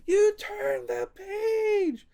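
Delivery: very high, slow, loud Grover cry. Retold or imitated. Grover shocked the reader turned the page, very high squeal. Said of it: "he's like".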